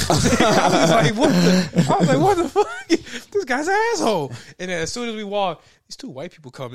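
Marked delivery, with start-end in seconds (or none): 1.2-2.2 a shocked, half-laughing What the; stunned what. Retold or imitated. Stunned reaction to rude man. 2.2-4.3 much higher and louder, laughing through outrage; yelling in disbelief at a jerk; outraged disbelief. Retold or imitated. Outraged retelling of rude man.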